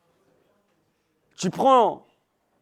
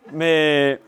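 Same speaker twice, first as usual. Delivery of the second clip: laughing; 3 semitones lower than usual. Real, not acted. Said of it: "il y en a qui prient que je m'étouffe".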